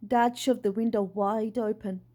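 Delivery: plain reading voice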